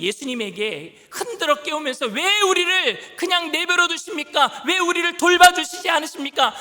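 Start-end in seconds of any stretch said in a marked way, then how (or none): 2.1-6.5 higher, louder, exasperated demand; irritated complaint. Acted. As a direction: snap back in frustration. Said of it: "신경질적인 반응을 보이니까".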